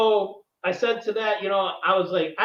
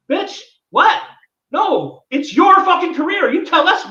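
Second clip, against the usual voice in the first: loud, much higher exasperated outburst; furious, rising shout of disbelief; outraged; 8 semitones higher, 7 dB louder than usual. Real, not acted. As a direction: blow up in disbelief at her excuse. Outraged reaction to her excuse.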